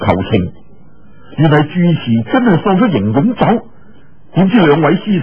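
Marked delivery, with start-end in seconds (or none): none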